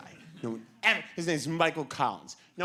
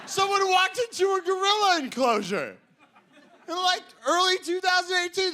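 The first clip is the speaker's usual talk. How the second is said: high-pitched comic exasperation; incredulous rant; 12 semitones higher than usual. Acted. Comedian questions telling a gorilla, incredulous. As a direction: push it up high and stay there, aghast.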